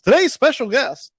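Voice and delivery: loud, high announcer intro; radio host voice. Shown as a demonstration. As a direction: boom it out like a radio DJ. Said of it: "saying it like a, a radio host".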